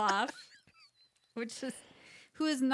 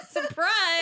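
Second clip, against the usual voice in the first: loud high "Surprise!"; spring it on her loudly and high; a cheerful, loud reveal of the hidden snack; playful surprise reveal; 11 semitones higher, 7 dB louder than usual. Acted. Unveils the secret snack.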